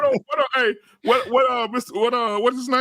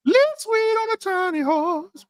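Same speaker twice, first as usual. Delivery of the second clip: put-on quoting the ad boy; 9 semitones higher than usual. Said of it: "That boy said".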